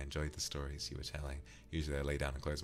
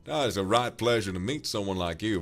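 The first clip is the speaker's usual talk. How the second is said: a louder, hammed-up cowboy accent; showy cowboy accent; 4 semitones higher, 10 dB louder than usual. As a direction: play the over-the-top cowboy greeting. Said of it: "made the character very like".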